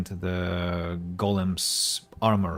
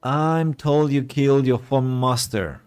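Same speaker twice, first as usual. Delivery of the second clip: put-on a light posh English accent, higher and louder; mock posh English accent; 5 semitones higher, 5 dB louder than usual. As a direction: read the line like a prim English butler. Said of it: "it's very posh, it's quite posh".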